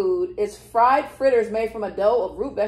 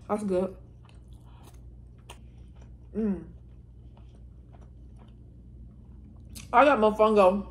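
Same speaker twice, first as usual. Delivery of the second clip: talking between bites; eating while speaking; mouth full, chewing; 6 dB quieter than usual. Real, not acted. Seen 0: Face clear; forkfuls taken at 1.9–2.4, chewing throughout the words.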